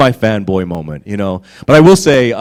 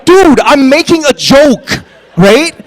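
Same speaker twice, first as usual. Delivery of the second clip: high, loud, drawn-out comic protest; exasperated protest; 12 semitones higher, 7 dB louder, slower than usual. Retold or imitated. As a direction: plead loudly that it was only kidding. Acted protest at humorless co-star.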